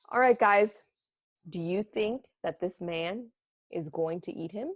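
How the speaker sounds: usual speaking voice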